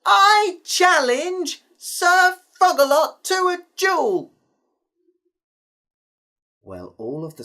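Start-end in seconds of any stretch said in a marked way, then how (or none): 0.1-4.3 high, loud, slow challenge called across the crowd; calling out. A narrator's reading. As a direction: shout it out across the castle. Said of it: "called out".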